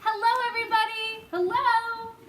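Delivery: high, loud, quick sing-song greeting; boy waving and calling to the crowd below. A narrator's reading. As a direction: call down cheerfully to the crowd. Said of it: "laughing and waving and calling out".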